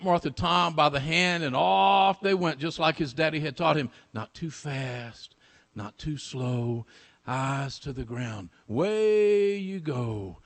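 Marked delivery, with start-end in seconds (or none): 4.1-8.5 slow, soft, low, spaced phrases; hushed, drawn-out stealthy recital; measured refrain. Acted. Teller echoes father's slow lesson. 8.7-10.4 very slow, stretched send-off; lingering, half-chanted phrase; drawn-out refrain. Acted.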